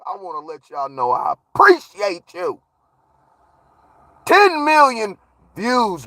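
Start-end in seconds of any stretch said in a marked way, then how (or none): 4.3-6.1 excited. Real, not acted. Celebrating view count.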